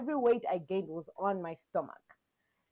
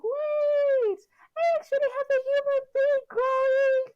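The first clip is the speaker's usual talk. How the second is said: high, loud amazed squeal; excited awe; 6 dB louder than usual. Retold or imitated. Mother recalling the first kick, squealing in amazement.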